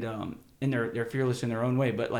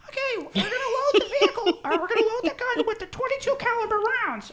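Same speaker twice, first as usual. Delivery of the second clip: much higher, squeaky kid imitation; high squeaky boy voice explaining the gun; squeaky teen voice; 5 dB louder than usual. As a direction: mimic a squeaky-voiced fourteen-year-old. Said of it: "the squeaky voice".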